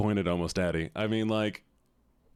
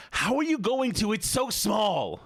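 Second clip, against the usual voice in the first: much higher, stretched out in disbelief; shocked squeal; 12 semitones higher, slower than usual. Real, not acted. Streamer reacting to the fan art, shocked squeal. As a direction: squeal it, aghast.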